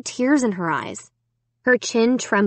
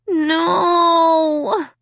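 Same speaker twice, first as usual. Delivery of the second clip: long, high wail of dismay; moaned; 9 semitones higher, 3 dB louder than usual. A narrator's reading. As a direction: moan it out high and drawn-out. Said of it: "I moaned".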